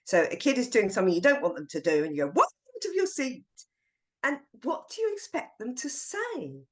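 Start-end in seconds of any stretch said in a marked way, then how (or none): 2.3-3.4 high, pointed rhetorical question; mock teacher reproach; exasperated teacher question. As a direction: challenge the kid's mess. Teacher scolding kid rhetorically.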